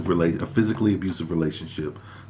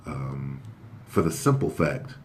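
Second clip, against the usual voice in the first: ordinary talk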